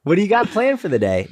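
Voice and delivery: high, over-friendly question; mock small talk. Playing a greeter making conversation, high and chirpy. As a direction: chirp it like an eager neighbor.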